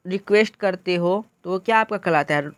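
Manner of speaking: usual speaking voice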